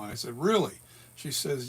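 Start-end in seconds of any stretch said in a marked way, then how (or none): 0.4-0.8 higher, louder doubtful really; surprised question. Retold or imitated. Answering the nurse's reassurance, surprised and doubtful. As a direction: sound caught off guard.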